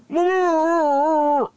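very high, loud falsetto laugh; a long squealed cackle; shrieking laugh. Acted. Shrieking laugh after Jerry Junior.

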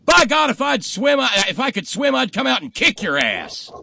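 loud, high shouted bluster; hammed-up furious roadside yell; indignant threat. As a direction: holler an empty threat across a field. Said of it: "blonde at the side of the road says".

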